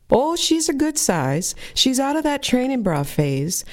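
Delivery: mother's voice. Retold or imitated. Narrator voices mother appraising daughter.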